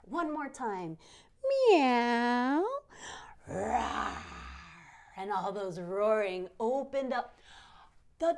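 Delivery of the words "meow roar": playful meow and roar. Pretend. Cat and lion sounds.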